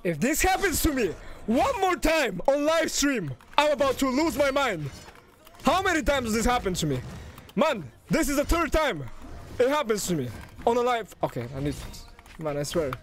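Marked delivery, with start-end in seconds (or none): 0.0-11.1 exasperated rant. Real, not acted. Streamer about to lose his mind on live stream, exasperated. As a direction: rant like you're at your limit. Rants at repeated mishap on stream.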